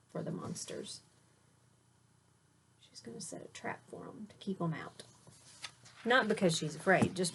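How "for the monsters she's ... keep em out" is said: whisper. Real, not acted. Whispered aside to child.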